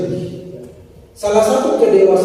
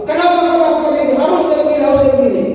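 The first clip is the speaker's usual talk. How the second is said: higher mock rebuke, insisting; acted angry scolding; 7 semitones higher, faster than usual. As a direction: play the self-righteous boss scolding Yosef, pitched up. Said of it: "Saya marah sama Yosef".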